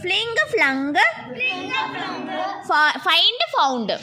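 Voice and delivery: slow, drawn-out teacher chant; measured classroom verb recitation; repeat-after-me drill. Shown as a demonstration. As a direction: stretch each word for the class to echo. Leads word repetition drill.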